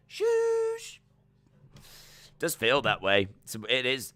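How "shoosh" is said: long, high drawn-out shoosh; mimicked shoosh. Shooshing the crowd like the wrestler, drawn-out high shoosh. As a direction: draw out a piercing shush.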